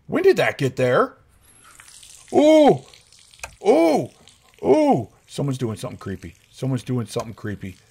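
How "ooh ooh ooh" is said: high, loud frightened ooh; spooked ooh. Real, not acted. Gamer spooked by creepy sounds. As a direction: yelp each time.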